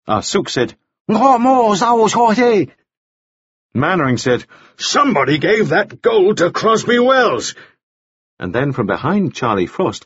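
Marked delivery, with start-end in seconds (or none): 1.1-2.7 high, slow Cantonese protest; urgent outburst. A narrator's reading. Ah Suk speaks over the other men, urgent outburst. 4.8-7.5 high, slow, emphatic assertion; urgent outburst. A narrator's reading. Mannering argues over three other men, urgent outburst. All four men speak at once.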